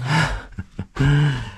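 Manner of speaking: short jittery laugh before the climb; nervous laugh. Real, not acted. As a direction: laugh off the nerves. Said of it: "That's a nervous laugh".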